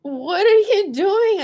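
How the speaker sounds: high, loud, slow "What are you doing?"; shocked question. Retold or imitated. She turns on the bus, shocked and loud. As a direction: stretch it out in stunned disbelief. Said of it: "and I'm just like".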